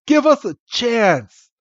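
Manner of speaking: high, slow cartoon pleading; mock plea. Podcaster voicing Ash begging for a chance, mock plea. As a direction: beg in an exaggerated, stretched-out way. Acted plea in anime retelling.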